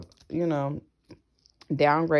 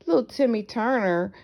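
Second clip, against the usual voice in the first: mock pity; 5 semitones higher, slower than usual.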